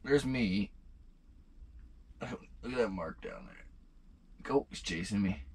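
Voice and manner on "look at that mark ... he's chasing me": hushed. Real, not acted. Watching the fish on sonar.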